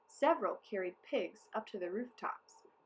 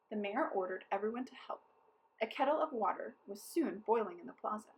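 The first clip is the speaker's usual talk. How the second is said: ordinary delivery, nothing marked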